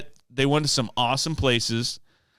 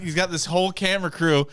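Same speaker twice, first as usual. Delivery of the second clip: usual speaking voice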